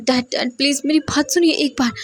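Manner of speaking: desperate pleading. A narrator's reading. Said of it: "जल्दी से बोलता है".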